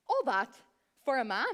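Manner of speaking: loud, incredulous "all that for a man"; a lightly voiced heckler; mock audience scoff. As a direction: play a sceptic in the crowd, scoffing. Voicing the audience's imagined doubt.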